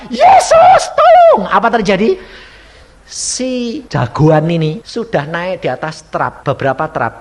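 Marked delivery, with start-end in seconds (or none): 0.1-1.4 very high, loud shriek; panicked maid yelling at full voice; screamed cry for help. Acted. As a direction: scream desperately for rescue. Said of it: "mau teriak-teriak".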